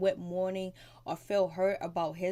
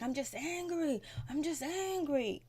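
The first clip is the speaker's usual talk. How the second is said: high, whiny-mad protest without shouting; imitated angry outburst; 8 semitones higher than usual. Recapping Ian defending himself to Trevor, mock angry. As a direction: mimic him insisting, pitch jumping up. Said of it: "he was really mad, yelling".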